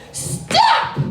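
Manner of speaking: loud, high acted yell; child's commanding shout. Four-year-old yelling at two fighting cats, sharp shout. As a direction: burst out like an indignant little kid.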